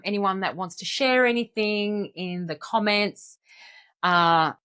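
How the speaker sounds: ordinary delivery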